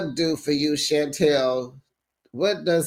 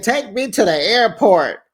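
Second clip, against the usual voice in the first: heated demand; 6 semitones higher, 6 dB louder than usual. Retold or imitated. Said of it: "She said".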